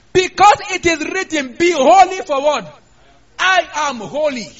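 high, loud preaching of the verse; booming, slow scripture declaration; loud proclamation. Real, not acted. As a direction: cry the verse out to the church.

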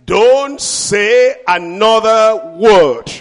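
very slow, firm command. Shown as a demonstration. Preacher demonstrating authority to the church, slow firm. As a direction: silence them with authority. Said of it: "That's authority".

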